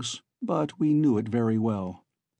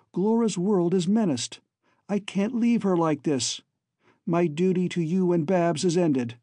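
higher-pitched, urgent son; earnest young Alan; 5 semitones higher than usual. Acted. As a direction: plead with your father as a determined son. Voices Alan pleading with father.

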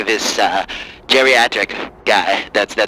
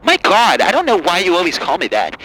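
higher, whiny outraged liberal; angry liberal caller; 6 semitones higher than usual. Acted. Caller ranting at the host in a higher outraged voice. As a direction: rant like a fed-up caller. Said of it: "Hi, Angry Lib".

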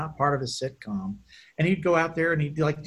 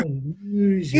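magician catchphrase; 5 semitones higher, 4 dB louder, slower than usual. Retold or imitated.

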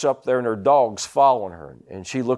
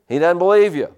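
full-force preaching; 2 semitones higher, 4 dB louder than usual. Acted. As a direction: preach it out to the congregation.

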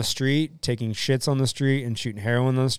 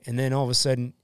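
normal talking voice

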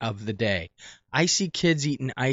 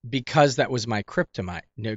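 usual speaking voice

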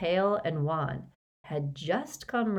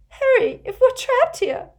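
put-on much higher, louder panicked girl's voice; horror-struck; 11 dB louder than usual. A narrator's reading. Hermione to Harry, terrified of being trapped. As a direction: blurt it in alarm.

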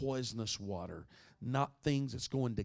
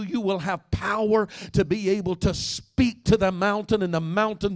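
loud, higher, climbing sermon cry; preaching at full force; 8 semitones higher, 12 dB louder than usual. Acted. Pastor rousing the congregation to connect, preaching at full force. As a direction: preach it out to the whole room, building.

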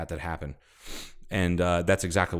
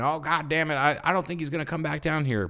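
higher, mock exasperated grumble; grumbling Terry; 8 semitones higher than usual. Terry dismissing his friend's warning, mock exasperated. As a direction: brush off the warning with annoyance.

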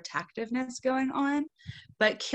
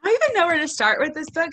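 gushing; 7 semitones higher, 6 dB louder, much faster than usual. Real, not acted. Opening gush about the book.

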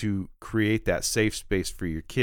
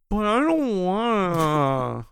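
put-on much higher, slow childish whine; whiny man-child; 9 semitones higher, 4 dB louder, much slower than usual. Dad refusing his wife like a sulky child, whining. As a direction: whine it like a sulking kid. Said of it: "expression on his face is just like".

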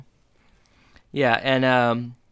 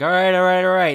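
high, loud repeated exclamation; loud fluster; 6 semitones higher, 7 dB louder, faster than usual. Retold or imitated. Eli backing in through the door, loud and flustered. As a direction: blurt it out louder and higher.

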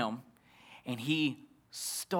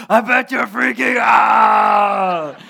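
long, drawn-out high shout; buddy's gleeful yell; 6 semitones higher, 12 dB louder, much slower than usual. Retold or imitated. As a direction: holler it slowly, stretching the end. Buddy yells pulling up in car.

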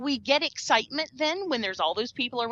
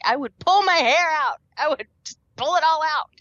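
higher-pitched, exasperated overstatement; mock exasperation; 6 semitones higher, 4 dB louder than usual. Speaker sick of hearing a bad accent, mock exasperated. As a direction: exaggerate being driven up the wall.

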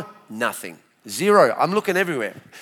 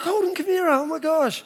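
surprised delight; 12 semitones higher than usual. Retold or imitated. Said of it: "I was like".

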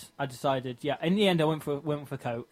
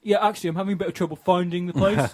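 higher, louder imitation of a driver; pizza delivery guy; 5 semitones higher, 6 dB louder, faster than usual. Lost driver phoning the customer, imitated delivery-guy voice. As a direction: mimic a delivery man asking for help. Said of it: "then says".

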